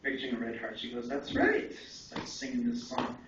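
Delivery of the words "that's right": lifted high, a hint of the old woman's voice; lightly voiced grandmother, pleased and sure; high approving grandmother. Grandmother confirming the red heart he pictured, high lightly imitated approval.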